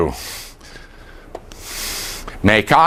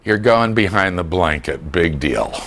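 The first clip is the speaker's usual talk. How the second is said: put-on unimpressed baby; 7 semitones lower, 3 dB quieter than usual. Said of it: "And they're going".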